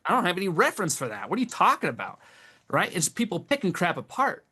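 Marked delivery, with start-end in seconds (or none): 0.1-2.2 fast, higher-pitched defensive retort; exasperated, baffled comeback; incredulous protest. Retold or imitated. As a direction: protest in disbelief, pitch jumping up. Retelling his protest to accusers.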